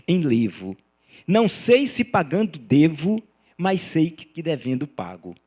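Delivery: loud, measured declamation of verse; poem recitation. Acted. Lecturer reciting a captive poet's décima as example, measured declamation. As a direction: declaim line by line, pressing each opening.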